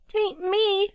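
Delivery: very high, squeaky little owl. A narrator's reading. Littlest owl denying blame, squeaky cry. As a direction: squeak out a denial. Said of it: "cried a little squeaky voice".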